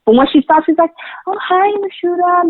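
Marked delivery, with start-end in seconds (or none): 1.3-2.5 very gentle. Retold or imitated. Imitating the singer greeting her makeup artist, very gentle. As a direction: imitate her gentle offstage hello, lifted high. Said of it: "Like, very gentle".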